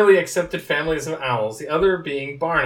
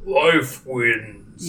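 put-on slow game creature voice; Maokai attempt; 3 dB louder than usual. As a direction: keep it down there, stretched out. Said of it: "kinda down there".

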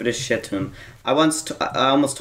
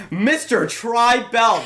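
higher, drawn-out playful call; calling across hallway; 7 semitones higher, much slower than usual. Retold or imitated. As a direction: slowly sing out the teacher's name down the hall. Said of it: "I'll be across the hallway".

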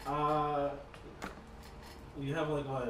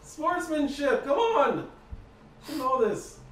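outraged scolding; 10 semitones higher, 11 dB louder than usual. Acted. GM as NPC scolding player.

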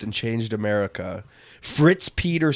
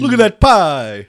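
high, shouted mock New York accent; 7 semitones higher, 11 dB louder than usual. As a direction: holler like a caricature New Yorker. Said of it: "This is my New York".

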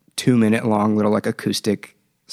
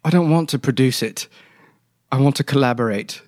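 a lightly voiced, refined British accent, pitched up; a posh British producer, lightly sketched; understated refined British accent; 3 semitones higher than usual.

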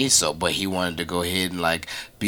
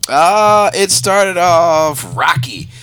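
emphatic; 8 semitones higher, 8 dB louder, much slower than usual. Real, not acted. Guest describes rough show.

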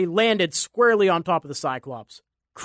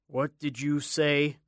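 slow, hard, menacing question; hardened voice; 4 dB quieter, slower than usual. Acted. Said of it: "Jack's voice hardened".

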